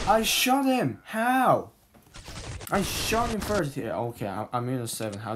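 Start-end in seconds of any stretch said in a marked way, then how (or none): 0.1-3.8 high, loud outraged yelling; raging gamer. Real, not acted. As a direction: yell it at the screen in disbelief.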